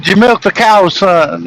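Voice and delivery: a bright eager fatherly question; mock dad question. Dad retelling his question to his son, lightly hammed up.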